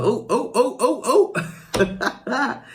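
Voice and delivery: slow-motion panic while falling; alarmed oh oh. Retold or imitated. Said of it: "I was like".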